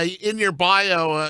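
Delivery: normal talking voice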